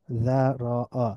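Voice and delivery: যারাআ slowly as dha, ro, 'a; syllable by syllable. Shown as a demonstration. Teacher breaking down the word, যারাআ syllable by syllable. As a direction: split যারাআ into three drawn-out syllables. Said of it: "একটু মিলিয়ে মিলিয়ে পড়ব".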